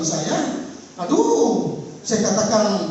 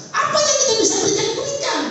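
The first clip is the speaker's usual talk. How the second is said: much higher, fast exhortation; urging; 12 semitones higher, faster than usual. Real, not acted. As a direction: press the people to give. Preacher urging the congregation. (mendesak jemaat, bernada tinggi dan cepat)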